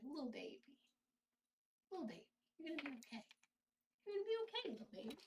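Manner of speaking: very soft, soothing coo; comforting baby talk. Retold or imitated. Owner comforting the stressed cat, soft soothing baby talk. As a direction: re-enact gently comforting a frightened pet. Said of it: "I had to comfort her".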